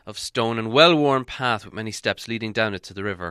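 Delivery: plain reading voice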